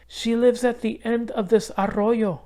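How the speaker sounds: put-on light, high girl's voice; young girl Isabel. A narrator's reading. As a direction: lift the voice up for the little girl.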